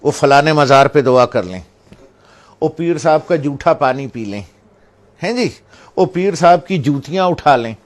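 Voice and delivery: loud, high, urging voice; mock followers clamouring for blessings; mimicking eager devotees. Mimicking people running after saints.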